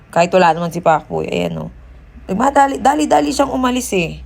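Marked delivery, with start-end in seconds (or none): 2.3-4.2 excited. Real, not acted. Excited retelling of stranger fleeing.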